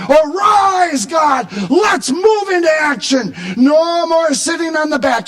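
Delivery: high, rousing shout; rally God into action; impassioned, ringing call to arms; triumphant battle call. Acted. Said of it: "a triumphant call to go into battle".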